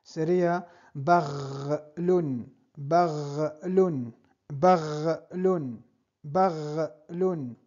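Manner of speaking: baghlun with a rolled throaty gh, repeated slowly; Arabic drill. Shown as a demonstration. Teacher models baghlun for learners, slow and clear. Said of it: "Entonces se".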